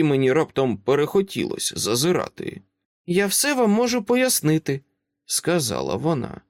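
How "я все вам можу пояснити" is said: put-on high child voice, calm and grown-up; a small girl who talks like an adult; composed little girl. Child asks Dresden to let them in, composed and high.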